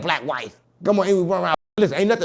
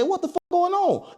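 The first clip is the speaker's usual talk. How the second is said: mock outrage; slower than usual. Retold reaction to being pulled over.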